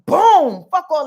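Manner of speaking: loud, punched-out boom; explosive boom. Acted. Astrologer sounds Leo energy crashing in, explosive. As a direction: burst out with a booming exclamation.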